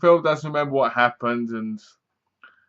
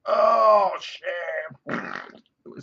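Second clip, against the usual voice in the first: mock groan; 3 semitones higher than usual. Imitating background toilet noise.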